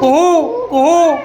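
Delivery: long hooting koo-hoo of a koyal; cuckoo call. Shown as a demonstration. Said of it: "તું છે ને કોયલનો". (કોયલનો ટહુકો)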